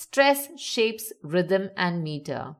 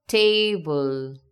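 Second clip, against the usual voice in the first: "Table" with a drawn-out, louder first syllable; stress on first syllable; 4 semitones lower, 4 dB louder than usual. Shown as a demonstration. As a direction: lean hard on the "Ta" in "Table". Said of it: "The stress here is on te".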